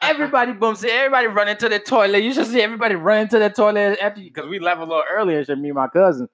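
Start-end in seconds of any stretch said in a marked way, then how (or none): 0.0-4.3 fast, laughing West Indian dad voice; West Indian accent. Retold or imitated. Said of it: "West Indian as fuck".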